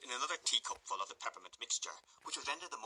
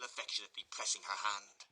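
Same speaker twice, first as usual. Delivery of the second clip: ordinary delivery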